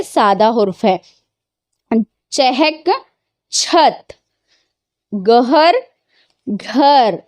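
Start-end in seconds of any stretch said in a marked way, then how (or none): 2.3-4.1 चहक with h apart, then छत with a breathy chh; ch-h against chh. Shown as a demonstration. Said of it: "pairs of words read aloud". 5.1-7.2 गहर with h apart, then घर with a breathy gh; g-h against gh. Shown as a demonstration. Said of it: "pairs of words read aloud".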